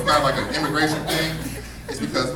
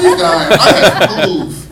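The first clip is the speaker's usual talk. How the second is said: put-on higher, loud laughing old-timer rant; old athlete griping, much louder with laughter; grumpy old-timer, louder; 3 semitones higher, 7 dB louder than usual. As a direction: rant like a washed-up ex-player laughing at himself.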